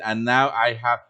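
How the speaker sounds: ordinary talk, nothing marked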